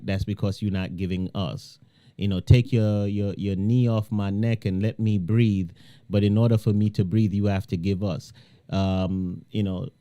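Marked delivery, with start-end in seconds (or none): none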